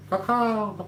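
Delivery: high, cawing crow noise. Retold or imitated. Calling out like the crows that follow him. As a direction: caw like a crow.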